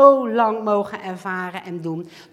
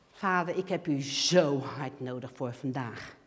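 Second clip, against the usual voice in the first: quiet, lower plea to the Father; soft pleading prayer; 8 semitones lower, 7 dB quieter than usual. Retold or imitated. Believer begging God for help, soft and humble. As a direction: ask humbly for help today.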